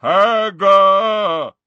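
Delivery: loud booming giant bellow. Acted. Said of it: "then bellowed".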